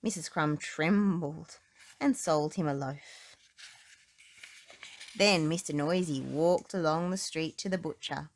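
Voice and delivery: plain reading voice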